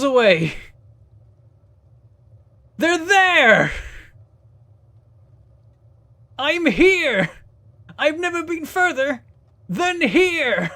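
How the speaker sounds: hammed-up frightened little newbie; mock panic.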